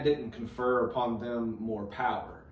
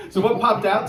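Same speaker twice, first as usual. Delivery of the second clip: laughing; 10 semitones higher, 12 dB louder than usual. Real, not acted. Still laughing, asks question.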